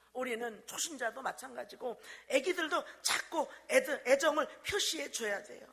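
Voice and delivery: loud, fervent pulpit preaching; preaching at full force. Acted. Pastor preaching to the church about caring, fervently. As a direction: drive it home to the congregation.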